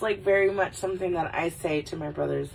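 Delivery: ordinary talk, nothing marked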